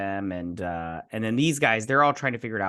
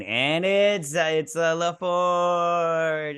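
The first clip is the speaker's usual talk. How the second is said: higher, sustained announcing tone; drawn-out reveal; 6 semitones higher, much slower than usual. Real, not acted. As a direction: draw out the name for the big reveal.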